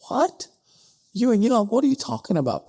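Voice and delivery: shrill high what, then fast; can't believe what he's hearing; incredulous disbelief. Retold or imitated. Settlers hearing Ewing Young's temperance plan, incredulous.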